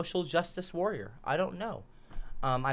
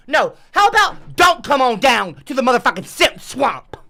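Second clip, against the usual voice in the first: loud, irritated counter to the invitation; sarcastic anger; 5 semitones higher, 9 dB louder than usual. Acted. Streamer retracts the swamp invitation, loud and irritated. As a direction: throw the invitation back angrily.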